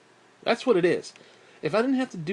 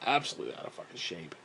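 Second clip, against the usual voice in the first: low, much softer mutter; muttered self-disgust; 10 semitones lower, 9 dB quieter, faster than usual. Real, not acted. Admitting he is out of shape, muttering. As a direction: mumble it under your breath. Self-mocking about his weight.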